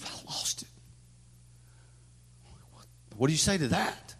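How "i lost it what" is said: unvoiced whisper, barely there. Real, not acted. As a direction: confess it in a whisper. Whispered confession.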